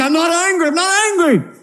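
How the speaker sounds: high, clipped sulky "I'm not angry"; sulky denial. Acted. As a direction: deny it through a sulk. Sulking husband voiced in quarrel.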